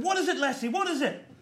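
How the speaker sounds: high, laughing worried farmer; hammed-up concerned farmer; mock farmer. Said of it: "And the farmer said".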